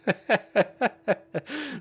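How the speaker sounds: sudden laugh; high burst of laughter. Real, not acted. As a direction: laugh out loud. Laughing at a funny clip.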